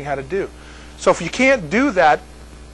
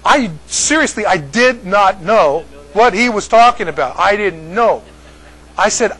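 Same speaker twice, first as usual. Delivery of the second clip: ordinary delivery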